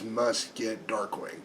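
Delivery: slow, soft, eerie monotone of mind-controlled people; hypnotized zombie crowd. Acted. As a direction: drone it out like you're in a trance. Hypnotized crowd chanting in unison.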